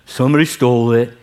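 low, sulky donkey whine; Eeyore voice. Retold or imitated. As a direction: complain like a gloomy Eeyore. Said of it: "His second line".

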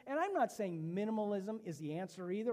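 ordinary talk, nothing marked

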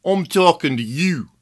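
put-on tossed-off Brooklyn accent. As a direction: say it like a pushy Brooklyn guy. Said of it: "in a Brooklyn accent".

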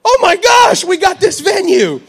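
retold thrilled shout; overjoyed. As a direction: cheer the good news out loud. Retelling his excited reaction.

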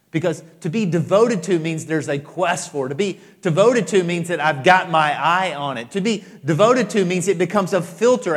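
preacher defining devotion; preaching at full force. Acted. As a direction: press each repetition harder.

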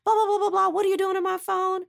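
high mock yell as Jeff; hammed-up high yell for the boyfriend's part; imitated yelling. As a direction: shout it back like the angry boyfriend.

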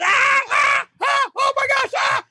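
high-pitched mock scream of pain; imitated hollering. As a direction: yelp it out like a man just hooked in the head. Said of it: "Dance hollers".

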